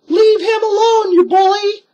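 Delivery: very high, loud angry shout; Annie crying out. A narrator's reading. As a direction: yell defiantly at the bully. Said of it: "she cried".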